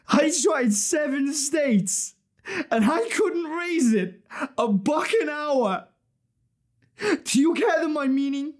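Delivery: high, strained, tearful plea; anguished confession. Acted. Failure confessing a buck an hour, anguished plea. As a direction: plead to be understood, voice pitched high.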